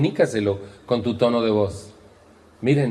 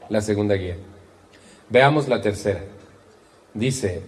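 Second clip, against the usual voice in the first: normal talking voice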